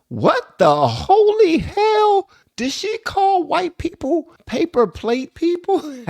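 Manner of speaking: very high, slow, loud squeal of shock; real amused disbelief. Real, not acted. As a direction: shriek it out, incredulous, stretching every word. Shocked reaction to clip's phrase.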